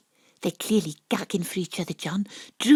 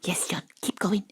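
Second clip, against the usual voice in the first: soft coaxing; 4 dB quieter than usual. Acted. Coaxing softly.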